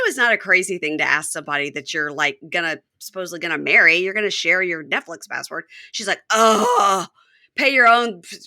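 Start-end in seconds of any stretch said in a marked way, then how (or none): 6.3-8.4 loud, higher-pitched mock exasperation; acted-out annoyed outburst; exasperated groan. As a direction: groan it out, imitating a fed-up girlfriend. Acting out her annoyed reaction.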